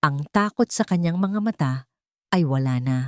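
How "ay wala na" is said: ordinary delivery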